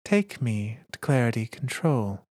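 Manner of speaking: ordinary delivery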